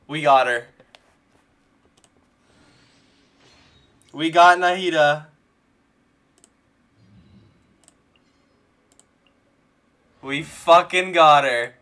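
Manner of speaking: loud, triumphant celebration. Real, not acted. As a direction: savor the win loudly. Celebrating pulled character.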